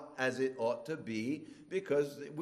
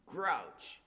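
normal talking voice

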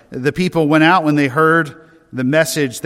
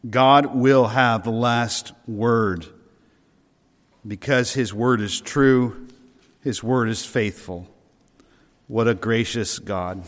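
solemn slow close; 3 semitones lower than usual. Acted.

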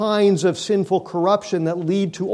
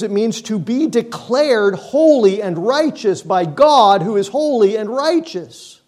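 ordinary talk, nothing marked

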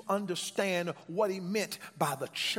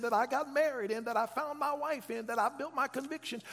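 ordinary delivery

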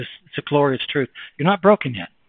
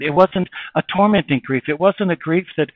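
ordinary talk, nothing marked

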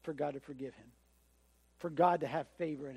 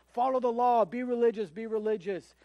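preachy teachers; 4 semitones higher, 5 dB louder than usual. Retold or imitated. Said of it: "they're telling you".

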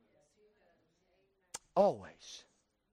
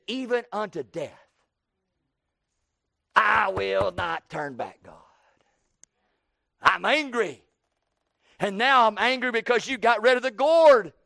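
angry Jonah; 3 semitones higher, 4 dB louder than usual. Acted. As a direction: dig in and rage at God. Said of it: "He said".

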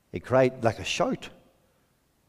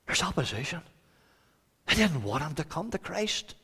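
ordinary delivery

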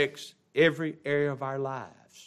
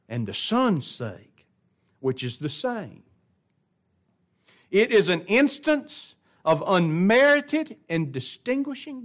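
pulpit reading; 7 semitones higher, 7 dB louder than usual. Acted.

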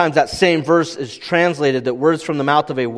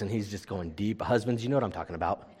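ordinary talk

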